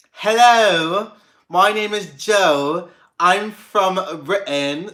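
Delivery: hammed-up British accent demo. Shown as a demonstration. Showing chat a British accent, hammed-up British accent. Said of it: "I honestly learned how to speak a British accent".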